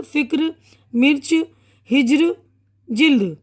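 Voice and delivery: each word spaced out; unhurried reading for beginners; one word, then a pause; slow and careful. Shown as a demonstration. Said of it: "मैं पहले थोड़ा इत्मीनान से पढ़ के".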